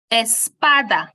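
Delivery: espada with the middle syllable PA stressed. Shown as a demonstration. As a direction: hit PA in espada. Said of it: "we place a stress on the pa".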